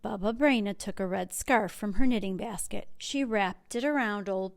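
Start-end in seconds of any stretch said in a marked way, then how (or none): none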